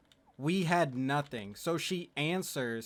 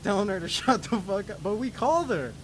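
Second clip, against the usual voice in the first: incredulous, higher-pitched laughing delivery; laughing while talking; 5 semitones higher, 3 dB louder than usual. Real, not acted. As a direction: voice climbing high through laughter. Cracks up at own story.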